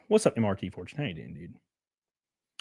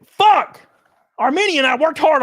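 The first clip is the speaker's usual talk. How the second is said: a real, much louder, higher yelp; sudden shout; 9 semitones higher, 5 dB louder than usual. Real, not acted. Streamer yelps at his chat, loud real outburst. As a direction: blurt it out loud, caught off guard.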